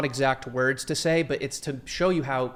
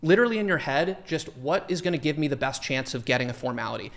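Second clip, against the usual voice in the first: normal talking voice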